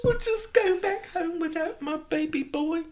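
slow, very high mock lament; hammed-up heartbreak. Mother going home without her boy, slow comic keening. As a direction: whimper it in an exaggerated high voice.

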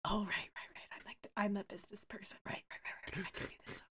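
whispered, nervous self-pep talk. Acted. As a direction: hush it, talking yourself into it. Nervous character psyching himself up.

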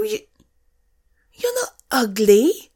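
normal talking voice

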